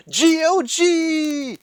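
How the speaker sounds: big loud drawn-out reveal; excited announcement. Pretend. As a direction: spell it out like a game-show host. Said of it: "Is that better?".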